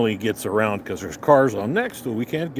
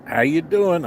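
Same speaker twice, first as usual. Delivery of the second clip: higher-pitched taunting hello; mock greeting; 7 semitones higher than usual. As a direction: call out a mock-friendly greeting to a rival driver. Hollering at driver while passing.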